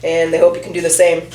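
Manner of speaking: normal talking voice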